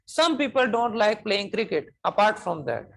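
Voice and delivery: teacher's model with a falling finish on "that"; falling intonation. Shown as a demonstration. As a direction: pronounce each word slowly, then bring "apart from that" down to a close. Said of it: "ये ऐसे खत्म कर".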